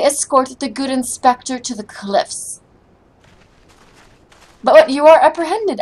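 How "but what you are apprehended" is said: higher and louder, caught off guard; alarmed protest. Acted. Inspector seized by the guards, alarmed protest. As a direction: blurt it out as the guards grab you.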